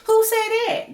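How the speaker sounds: sharp, very high mock-innocent squeal; Portia impression. Mimicking Portia denying she said it, high squeal. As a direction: squeak it out like a shocked reality star. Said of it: "that damn Portia".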